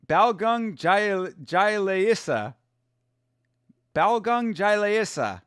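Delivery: slowly sounding out Balgung Jaileisa, syllable by syllable; careful slow attempt at Jaileisa as Jai-lei-sa; sounding out a name. Shown as a demonstration. Streamer slowly working out a fighter's name, careful pronunciation. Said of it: "what was it?".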